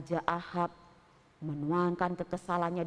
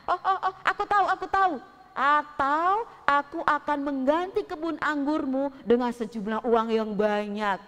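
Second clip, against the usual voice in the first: higher, louder, pushy king voice with a chuckle; a greedy king coaxing the owner; king Ahab pressing Nabot to sell; eager king; 5 semitones higher, 5 dB louder than usual. Acted. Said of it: "kata si Raja Ahab".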